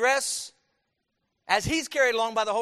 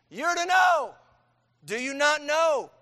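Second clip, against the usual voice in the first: higher, louder, slow demand; preacher's shout; 6 semitones higher, 7 dB louder, slower than usual. Acted. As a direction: hammer it at the congregation. Preacher pressing point.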